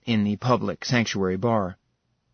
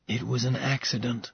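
much softer, barely above a murmur; quiet confession; 7 dB quieter than usual. Acted. Ilarion confesses the accident to Seraphina, softly. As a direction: admit it quietly, ashamed.

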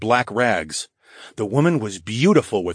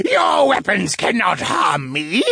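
high, slow, breathy monster shriek; maniacal creature; 11 semitones higher, 4 dB louder, slower than usual. A narrator's reading. Wraith mocks the hero's sword, maniacal shriek. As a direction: gloat in a drawn-out screech. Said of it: "the creature laughed maniacally".

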